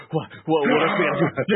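high, loud, eager outburst; amped-up hero voice, breaking into laughter; super stoked. Acted. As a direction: shout it out, raring to go. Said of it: "super stoked for the rest".

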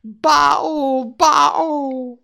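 high, loud cry from far off; distant scream. A narrator's reading. Faline fleeing Bambi, screaming from afar. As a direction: scream while running away. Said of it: "could still be heard as she got further".

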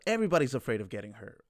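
ordinary talk, nothing marked

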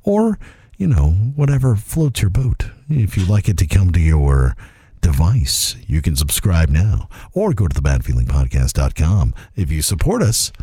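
smooth, unhurried radio read. Pretend. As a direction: lay it on like public radio. Podcast plug in NPR voice.

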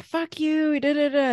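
high-pitched imitation of a tantrum; mock angry retort. Angry child part rejecting the adult, mock angry retort. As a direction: rattle off mock abuse in a high voice.

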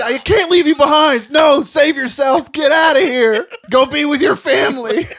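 high, loud melodramatic shouting; hammed-up heroic plea. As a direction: beg your buddy to save himself, overacted. Mock heroic refusal to leave.

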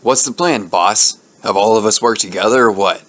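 mildly snapped question at the boss; snapping. Acted. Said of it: "One Up snapped".

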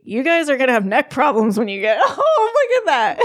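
teasing dad. Retold or imitated. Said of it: "my dad used to make fun".

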